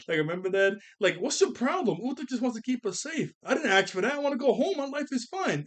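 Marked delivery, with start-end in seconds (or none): 1.2-5.6 high-pitched mock bickering voices; imitated complainers in a raised, high voice; arguing crowd. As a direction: act out a crowd squabbling, pitched way up. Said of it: "a really big argument going on".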